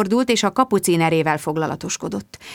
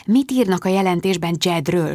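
ordinary delivery

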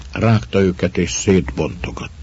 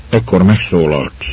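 plain reading voice

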